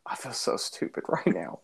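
whispered, ashamed; embarrassed whisper. Retold or imitated. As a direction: whisper it under your breath, mortified. Embarrassed before firemen.

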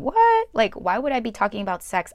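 sudden high loud what; shocked at the very idea; mock shock.

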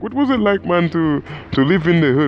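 high, mock Egyptian accent. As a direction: lay on a bad Egyptian accent. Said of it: "I do a terrible Egyptian accent".

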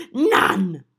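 eager, talking through food; mouth full. Acted. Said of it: "mouth full".

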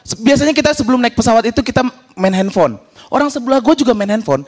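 ordinary delivery

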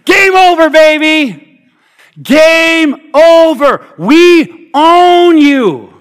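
high, loud trash-talk yell; high school punter yelling at the other team, loud trash talk; sideline yelling. Retold or imitated. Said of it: "I'm starting yelling".

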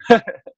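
loud bright chuckle; happy laugh. Real, not acted. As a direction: laugh with joy. Laughs about going home.

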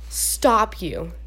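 ordinary delivery

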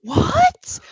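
high, shocked reenacted gasp. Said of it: "I gasped".

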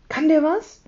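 very high, keen question; excited child's high query; eagerly curious. A narrator's reading. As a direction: ask eagerly, pitch jumping up. Leo eagerly asks its powers.

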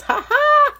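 very high, loud "ha ha"; high mock laugh. Preacher joking about how funny Jesus is, high mock laugh. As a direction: burst into a squeaky, put-on cackle.

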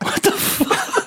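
breathless whisper of disbelief; stunned whisper; whispered shock. Real, not acted. Shocked by cult idea.

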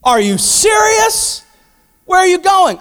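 high, loud, stunned shout; shocked disciples. Retold or imitated. Disciples shouting in shock at Jesus leaving. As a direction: hurl the disbelief, high and loud. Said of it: "And then Jesus is like".

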